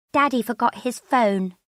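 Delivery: put-on high, loud child voice; little girl. Ella telling her mother about Daddy, little-girl voice. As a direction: pipe up like a small daughter. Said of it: "Ella tells me".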